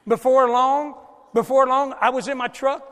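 excited. Real, not acted. Grandpa telling of playing Transformers in the truck, excited and loud. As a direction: relive the fun of the game. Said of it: "people thought I was crazy".

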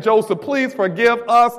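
high-pitched, drawn-out imitated plea; begging. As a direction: act out brothers pleading for mercy. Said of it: "almost groveling and begging and saying".

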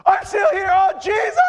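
very high, loud squeal of joy; ecstatic shout. Acted. Believer waking to see Jesus, ecstatic high shout. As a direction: shriek it in overjoyed amazement.

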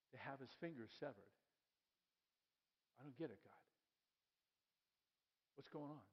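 hushed pleading prayer. Retold or imitated. Habakkuk pleading softly with God over the evil he sees. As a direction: ask God quietly, almost under the breath.

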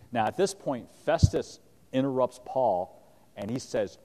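ordinary talk

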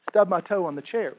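normal talking voice

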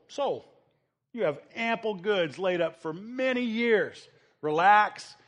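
lightly voiced rich man coaxing himself; smug rich man. Rich man talking to his own soul, lightly smug. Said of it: "And I will say to my soul".

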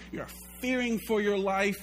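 normal talking voice

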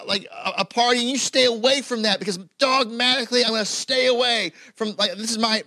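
high, loud impassioned sermon; full-force preaching. Acted. Pastor urging listeners against small temptations, impassioned preaching. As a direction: drive the point home to the congregation.

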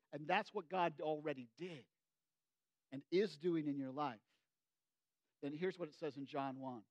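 usual speaking voice

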